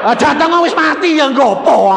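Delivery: high, loud scoff in another voice; dismissive relative. Retold or imitated. Relative loudly dismissing the trip, high and scornful. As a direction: wave it away loudly.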